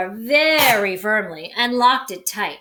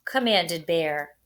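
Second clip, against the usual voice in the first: plain reading voice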